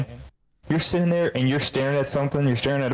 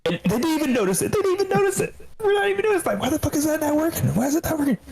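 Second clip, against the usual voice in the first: much higher, fast, building exasperation; worked-up retelling; faster than usual. Real, not acted. Engineer retelling the missed typo to friends, rising excitement. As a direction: get more and more wound up.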